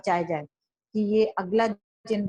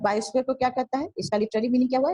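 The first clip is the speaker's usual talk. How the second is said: normal talking voice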